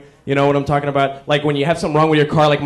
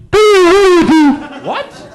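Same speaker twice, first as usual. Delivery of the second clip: high, loud squawked call; 6 dB louder than usual. Retold or imitated. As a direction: blare it like a tinny PA. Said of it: "you hear, like".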